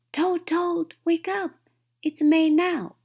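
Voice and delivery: high, loud wake-up call; excited shout. A narrator's reading. Frog waking Toad with the news it's May, loud and high. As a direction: shout the news right at the bed.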